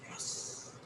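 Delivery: breathy whisper, barely voiced; whispered yes. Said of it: "I was like".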